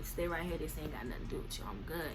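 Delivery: fast, hushed reassurance. Retold or imitated. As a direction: tell her quietly to wait. Quietly telling girlfriend.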